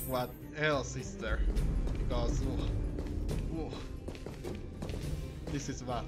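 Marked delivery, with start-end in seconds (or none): none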